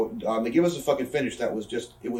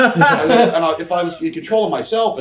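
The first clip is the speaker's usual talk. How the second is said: high and loud, laughing through the words; real laughter spilling into the sentence; laughing hard; 5 semitones higher, 8 dB louder than usual. Real, not acted. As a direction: crack up while trying to go on. Laughing hard mid-story.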